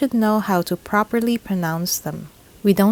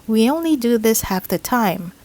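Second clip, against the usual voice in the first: ordinary talk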